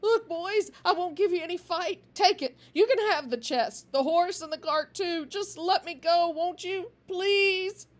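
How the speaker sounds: high, loud, fast pleading for mercy; trembling plea. A narrator's reading. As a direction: beg the gang for your life. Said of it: "his voice trembled as he pleaded for mercy".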